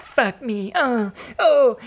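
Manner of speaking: imitating a man choking up, high and shaky; a hammed-up tearful break; mock crying. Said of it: "he cries so much".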